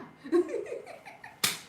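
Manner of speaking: high breathy giggle; high laugh. Real, not acted. Mocking her husband's excuse, high giggle. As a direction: giggle at his lame excuse.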